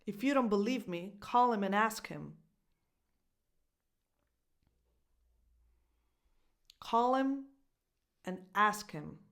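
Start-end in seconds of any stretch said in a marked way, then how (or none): 1.2-9.2 "call" and "ask" stressed, each "him" reduced to "im"; no H on either "him", stress on the verbs; dropped H. Shown as a demonstration. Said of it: "No H sounds".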